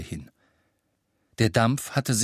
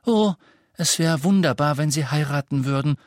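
lightly voiced enchanted little girl, higher; delighted; 6 semitones higher, 4 dB louder than usual. A narrator's reading. Little sister imagines a wedding, delighted. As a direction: swoon with delight at the idea. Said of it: "flüsterte Lily entzückt".

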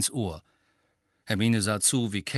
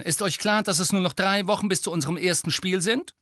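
high, urgent team-captain rush; raised, eager, driving voice; beaming, excited; 8 semitones higher, 4 dB louder than usual. A narrator's reading. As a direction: rattle it out with triumphant energy. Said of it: "strahlte Angelina".

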